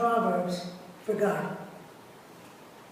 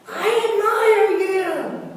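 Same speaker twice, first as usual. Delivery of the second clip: high, loud, slow echo of the shout; echo answering; 8 semitones higher, 5 dB louder, slower than usual. A narrator's reading. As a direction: let it ring back from far away. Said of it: "The voice answers".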